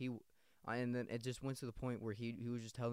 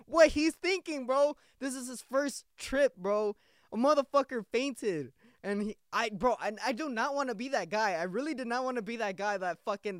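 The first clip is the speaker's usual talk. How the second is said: much higher and louder, rushing with alarm; frantic, worked-up retelling; 10 semitones higher, 8 dB louder than usual. Retold or imitated. Telling a friend about fainting on his first trip, higher and louder with alarm. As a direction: pitch it up high, as if the panic is happening again.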